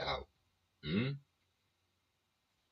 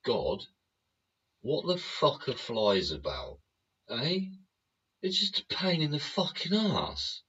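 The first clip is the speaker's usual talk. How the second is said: exasperated plea; 8 semitones higher than usual. Retold or imitated. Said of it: "we've asked this of God before".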